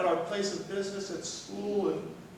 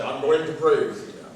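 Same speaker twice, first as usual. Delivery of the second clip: louder, pushy announcement of prayer; pushy demand; 11 dB louder than usual. Shown as a demonstration. Pastor demonstrating the wrong, pushy way to offer prayer, louder. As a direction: barge in like you're grabbing them. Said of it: "Don't grab and say".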